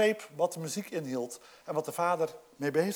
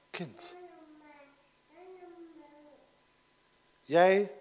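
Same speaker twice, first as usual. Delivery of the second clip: rustig en teder; 6 dB quieter than usual. Acted. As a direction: speak gently to your child. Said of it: "hij blijft rustig en zegt". (de vader spreekt zijn oudste zoon zacht en teder toe)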